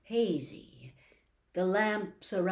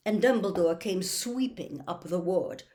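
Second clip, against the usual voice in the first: ordinary delivery, nothing marked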